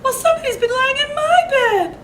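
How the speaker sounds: very high, loud, laugh-broken mama voice; a shrill cry half laughing; Mama Bear. A narrator's reading. Reading to kids, Mama Bear finds her bed used. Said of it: "cried Mama Bear".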